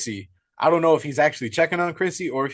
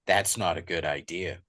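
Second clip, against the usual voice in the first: menacing; 9 semitones lower, 7 dB quieter than usual. Retold or imitated. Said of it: "from being this scared fucking fan to just absolutely pissed off".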